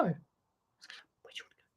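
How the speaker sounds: slow, soft whispered question to the parrot; whispered repeat. Acted. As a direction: drop to a slow hush for the bird. Coaxing the parrot to talk.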